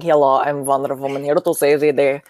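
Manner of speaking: put-on Russian accent. Joking demo of her Russian accent. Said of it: "I do, like, this Russian accent".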